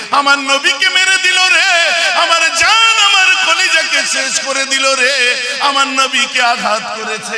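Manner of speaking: high, loud, drawn-out chanted wail; performed grief, sung at full voice; acted wailing lament. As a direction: cry out slowly like a distraught follower. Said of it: "পাগলের মতো কাঁদতে আরম্ভ করে দেয়".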